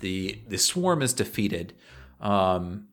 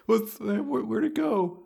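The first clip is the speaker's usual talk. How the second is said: much higher, anxious little voice; high pleading collar voice; 10 semitones higher, slower than usual. Acted. Collar voice again.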